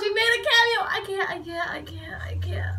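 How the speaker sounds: high outburst fading to a whisper; overwhelmed excitement. Real, not acted. Fan tells of the surprise cameo, overwhelmed, fading to whisper. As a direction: start high, then run out of voice.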